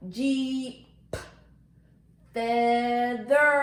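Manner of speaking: Jeep and feather each drawn out, endings stressed; sound-by-sound recap of Jeep, feather; stretched-out words. Shown as a demonstration. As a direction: hold each word long, popping the P of Jeep, holding the R of feather. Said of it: "match letters and sounds at the end".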